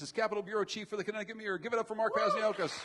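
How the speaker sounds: loud emcee introduction; announcer intro. Real, not acted. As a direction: call out each guest name. Introducing guests to applause.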